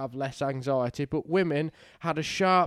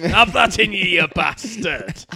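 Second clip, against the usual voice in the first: mock threat; 7 dB louder than usual. Mates joking about hammering a client, loud mock threat. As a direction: relish the mock blow.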